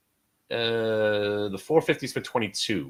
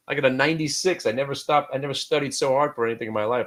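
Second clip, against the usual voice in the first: ordinary talk, nothing marked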